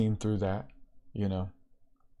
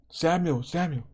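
lightly acted call, pitched higher; higher, falling name-call; 6 semitones higher than usual. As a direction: call a sleeper by name from afar, higher, without shouting. Voice calling the sleeping Samuel.

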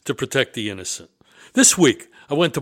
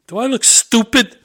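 high, loud exasperated challenge; indignant punchline; 9 semitones higher, 8 dB louder than usual. Real, not acted. After winning the limo standoff, loud fed-up challenge. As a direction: belt it out as the punchline.

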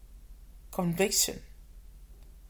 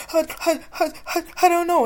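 stammering, much higher, choked between sobs; imitating a sobbing child; 11 semitones higher, 7 dB louder, faster than usual. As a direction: retell her broken sobbing words. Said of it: "she could hardly breathe and sobbing".

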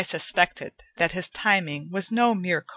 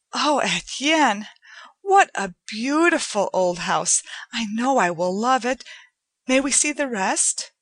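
excited; 5 semitones higher than usual. A narrator's reading. Joanna admiring the beautiful old house, excitedly. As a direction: gush with delight, lingering on the words. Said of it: "she spoke to me excitedly".

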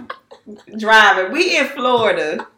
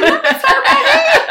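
laughing high quote; 13 semitones higher, 4 dB louder than usual. Retold or imitated. Retold reaction, laughing.

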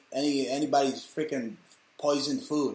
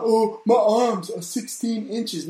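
mocking [name] lyric; 6 semitones higher, 4 dB louder, slower than usual. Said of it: "than the [name] song".

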